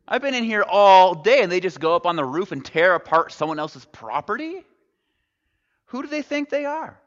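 outraged crowd member, rising high; indignant onlooker. Retold or imitated. Preacher voicing a grumbling crowd member, indignant. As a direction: act out the offended bystander. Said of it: "we'd all be like".

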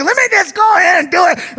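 mock rage. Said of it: "I was like".

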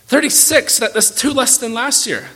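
put-on a pouting child complaining about his presents; spoiled Dudley whining.

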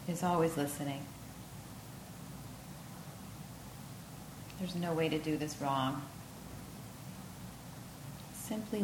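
unhurried yoga nidra voice with long pauses; guided meditation. Acted.

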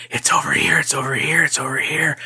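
repeated eerie, breath-heavy chant; insistent spooky half-whisper; ghostly voices. Retold or imitated. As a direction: echo it like ghosts closing in. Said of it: "And then I just hear".

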